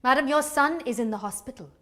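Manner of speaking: ordinary delivery, nothing marked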